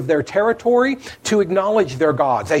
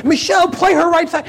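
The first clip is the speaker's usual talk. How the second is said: much higher, louder courtside yell; coach yelling; 10 semitones higher, 3 dB louder, faster than usual. Retold or imitated. Coach to her player Michelle during a game, loud yell. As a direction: shout the instruction across the gym. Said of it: "I'm yelling at Michelle".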